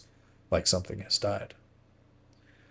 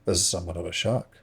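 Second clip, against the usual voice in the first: ordinary delivery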